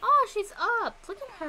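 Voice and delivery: high, loud, delighted exclamation; excited coo. Real, not acted. Gamer seeing the little character is up, excited coo. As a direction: burst out adoringly. Spots game child waking.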